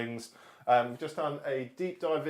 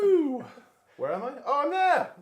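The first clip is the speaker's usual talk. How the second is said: excited; 12 semitones higher, 6 dB louder than usual. Real, not acted. Sees himself on stream.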